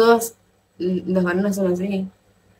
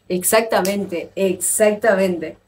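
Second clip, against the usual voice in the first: drawn-out agreement; slower than usual. Real, not acted.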